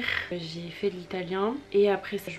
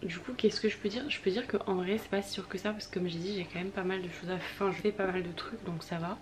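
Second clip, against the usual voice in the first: ordinary talk, nothing marked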